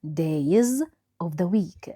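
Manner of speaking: Days of the Week drawn out slowly, each word clear; slow teaching read. Shown as a demonstration. Teacher models Days of the Week slowly for pupils. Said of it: "ننطقها بطريقة صحيحة".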